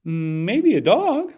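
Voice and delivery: put-on sweet, innocent little puppet kid; Kermie-style sweet kid; Kermit-like voice. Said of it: "a little Kermie voice".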